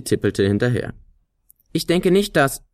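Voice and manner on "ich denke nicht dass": high, agitated start; aufgeregt. A narrator's reading. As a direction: blurt it out, worried. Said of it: "begann sie aufgeregt". (die Flöte beginnt hoch und aufgeregt)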